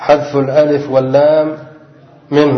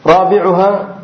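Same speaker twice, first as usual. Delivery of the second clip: normal talking voice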